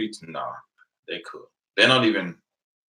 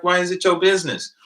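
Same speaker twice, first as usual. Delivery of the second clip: higher, louder pushback, retold; indignant retort; 11 semitones higher, 6 dB louder than usual. Driver answers prying policeman, indignant. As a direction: throw the question back, affronted.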